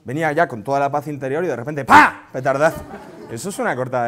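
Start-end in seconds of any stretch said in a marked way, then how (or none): 1.8-2.3 sudden high, loud bang; shouted explosion noise. Retold or imitated. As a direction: burst out like a firecracker. (estallido imitado a gritos)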